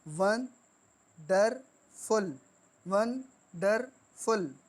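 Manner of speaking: Wonderful very slowly in three separate beats; three-syllable split. Shown as a demonstration. Teacher counting three sounds, wonderful broken syllable by syllable. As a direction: pause between वं, डर and फुल. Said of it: "तीन sound".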